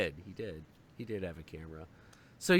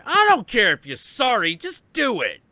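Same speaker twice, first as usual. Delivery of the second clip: higher and louder, no-nonsense; stern loud scolding; 8 semitones higher, 9 dB louder than usual. Retold or imitated. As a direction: shut down the apology hard. Scolding the kid.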